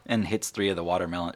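usual speaking voice

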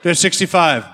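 loud, rising auctioneer bidding calls. Acted. Runs a charity auction.

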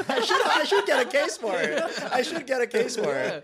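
laughing high while talking. Real, not acted. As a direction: giggle through the words. Guest laughs at the joke.

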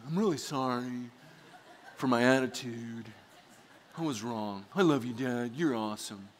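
soft, gentle imitation of a son; sincere apology. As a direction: apologize tenderly to your dad. Voicing son's sincere apology.

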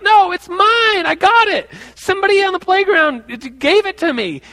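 put-on high, loud kid voice insisting; whiny little kid. Boy protesting to teacher, high whiny kid. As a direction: play the lying kid, high and pleading. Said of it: "the little kid next to me is like".

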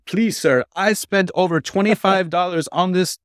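high begging customer; pleading customer voice. Retold or imitated. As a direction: beg like a desperate customer.

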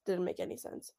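"Didn't make any sense" breathed out in a soft whisper; soft whispered echo of the complaint; whispered repeat. Real, not acted. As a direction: mutter it under your breath as a whisper.

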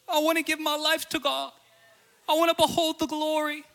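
mock-tearful kid pleading; tearful plea. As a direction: voice his tearful plea, rising. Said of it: "he begins weeping".

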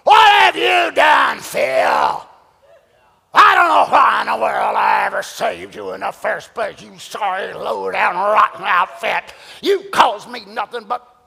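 angry God scolding. Retold or imitated. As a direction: thunder at the sinner like a wrathful judge. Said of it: "That's the way we picture God".

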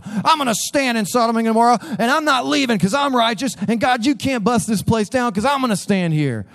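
high, hammed-up stubborn Abraham; mock a stubborn patriarch refusing to budge; defiant Abraham. Pastor mocking Abraham's stubborn stand, hammed-up defiance. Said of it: "it'd be like Abraham going".

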